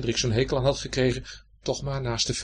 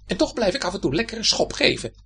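higher, defiant shoe voice; rebellious; 8 semitones higher, 3 dB louder than usual. Acted. The left shoe defies the right shoe, rebellious. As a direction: stick your chin out.

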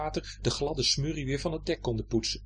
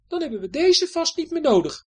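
high, cheerful voice; Betje; 7 dB louder than usual. Acted. Betje telling Hendrik the weapons are done, light. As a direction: toss it off lightly.

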